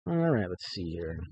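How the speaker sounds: ordinary delivery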